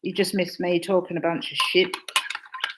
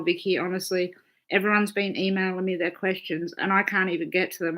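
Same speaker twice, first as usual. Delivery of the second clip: ordinary delivery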